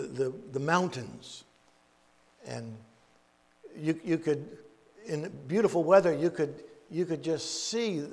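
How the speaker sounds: ordinary talk, nothing marked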